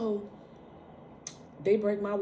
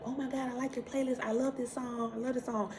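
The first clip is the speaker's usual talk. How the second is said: high, bubbly mock fan voice; gushing doctors; 8 semitones higher than usual. Doctors gushing over her playlist, in a high bubbly mock voice. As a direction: imitate chirpy doctors fawning over the music. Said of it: "And they like".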